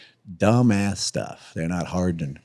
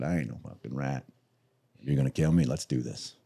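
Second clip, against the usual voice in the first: calm defiance; 6 semitones lower, 8 dB quieter than usual. Retold or imitated. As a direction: lower and much softer, steely. Standing up to fifty men.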